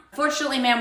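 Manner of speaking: ordinary delivery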